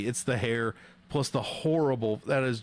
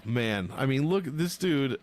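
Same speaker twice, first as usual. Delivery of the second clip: ordinary talk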